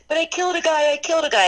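high-pitched mock distress of a soldier; imitated panic. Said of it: "like, in panic because he's like".

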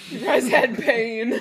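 slow laughing tease. Real, not acted. Laughing tease about childhood.

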